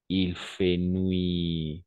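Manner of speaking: very slow, drawn-out model sentence; slow model phrase. Shown as a demonstration. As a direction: spell it out slowly for the class. Teacher models phrase slowly. (phrase modèle très lente)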